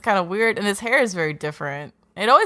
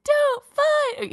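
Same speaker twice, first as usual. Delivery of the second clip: pleading son; much slower than usual. Retold or imitated. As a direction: beg your dad to stop, much higher. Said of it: "his son would be like".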